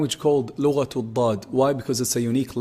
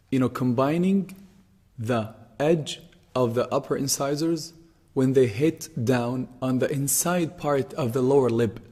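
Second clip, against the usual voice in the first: ordinary talk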